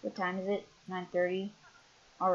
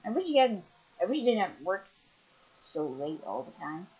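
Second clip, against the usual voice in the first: usual speaking voice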